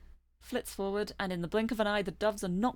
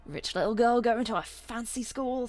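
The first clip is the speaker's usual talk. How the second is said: lightly voiced sneering punk bird; mock the rich girl like a street punk; taunting pigeon thug; 3 semitones higher, 3 dB louder than usual.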